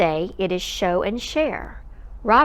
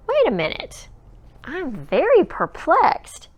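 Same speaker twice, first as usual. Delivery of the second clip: a dawning confusion; perplexed; 8 semitones higher than usual. Acted. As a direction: stretch it out, baffled. Sudden confusion in story.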